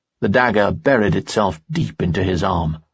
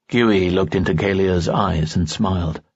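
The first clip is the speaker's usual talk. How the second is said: ordinary delivery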